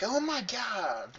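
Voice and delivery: giddy. Retold or imitated. Giddy crush feeling quoted.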